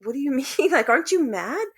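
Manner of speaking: fast, high, incredulous; stunned that the aunt stays calm, quick and high; retold disbelief. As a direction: sound baffled that she isn't angry. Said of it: "I, I was like".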